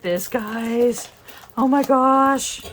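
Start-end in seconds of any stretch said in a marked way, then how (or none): 1.6-2.6 loud, slow delighted exclaim; excited gasp. Real, not acted. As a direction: burst out in delight. Delighted unboxing a cute kit.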